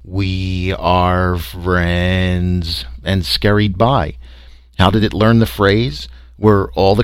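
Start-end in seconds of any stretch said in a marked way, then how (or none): 0.0-2.8 very slow monotone alien voice; toneless, drawn-out alien drone; drummed-out creature drone. A narrator's reading. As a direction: stretch each word on a single note. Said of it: "simply drummed out".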